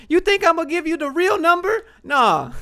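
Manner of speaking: high, loud, scoffing yell; scoffing shout. Real, not acted. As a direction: shout it like the idea is absurd. Ranting about fake job references.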